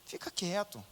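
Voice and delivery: soft, gentle hush; tender hushed advice; with tenderness. Shown as a demonstration. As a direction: tell him kindly to keep quiet. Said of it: "com muito carinho".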